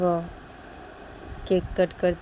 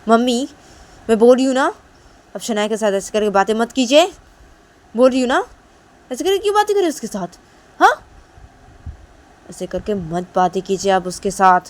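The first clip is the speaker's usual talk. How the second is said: loud, higher angry pleading; 5 semitones higher, 11 dB louder than usual. Acted. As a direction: snap back at mom, loud and high. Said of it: "गुस्सा हो जाती है और बोलती".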